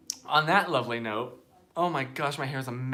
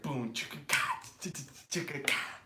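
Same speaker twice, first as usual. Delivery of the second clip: soft beatbox rhythm; imitated theme music; 5 dB quieter than usual. Host imitating show music, soft vocal beatbox. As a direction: mouth the percussion quietly. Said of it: "as the music goes".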